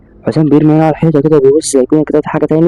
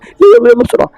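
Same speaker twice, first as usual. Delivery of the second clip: urgent hurry; 6 semitones higher than usual. Acted. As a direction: hurry them out in a panic. Fleeing the building. (استعجال بصوت عالي)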